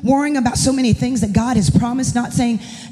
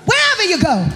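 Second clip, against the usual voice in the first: full-force preaching; 5 semitones higher than usual. Acted. Pastor's wife preaching God's command to Joshua to the church, full-force preaching. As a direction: proclaim the verse with rising fervor.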